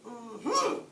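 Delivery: muffled through a gas mask. A narrator's reading. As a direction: cry out behind a mask. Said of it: "cried the wolf in a muffled voice".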